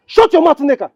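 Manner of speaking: sharp, high-pitched order to be silent; angry rebuke. Acted. Husband silencing his wife mid-quarrel, angry. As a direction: cut her off angrily, voice jumping up.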